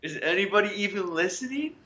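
lightly hammed appeal to absent listeners; mock plea into the void.

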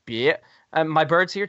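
ordinary talk, nothing marked

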